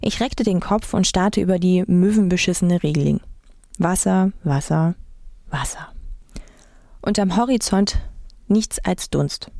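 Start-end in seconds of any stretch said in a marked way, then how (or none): 3.8-5.9 1 fading to a whisper. A narrator's reading. Fading repetition, empty sea.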